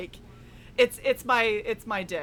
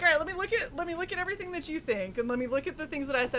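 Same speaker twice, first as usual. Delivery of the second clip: quick reflective self-quote; 6 semitones higher, much faster than usual. Retold or imitated. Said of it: "And then I'm like".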